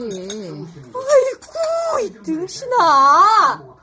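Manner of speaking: drawn-out crying wail, then a loud rebuke; wailing scold.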